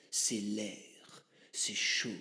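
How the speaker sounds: whisper. Acted. Shaman whispering mystic lore.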